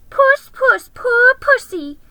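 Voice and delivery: very high, loud mocking coo; jeering. Creatures taunting Aslan, very high mocking coo. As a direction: croon it in cruel mockery. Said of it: "jeering at him".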